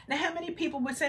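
normal talking voice

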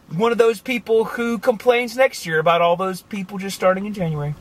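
ordinary delivery

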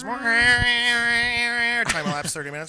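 drawn-out mock time-card voice, slow; slow announcer-style narration. Storyteller mocks the cat's endless yowling with a slow caption voice. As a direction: read it like a slow movie caption.

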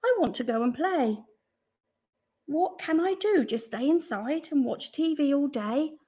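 put-on high, complaining child; whining.

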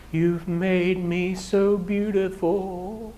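ordinary talk, nothing marked

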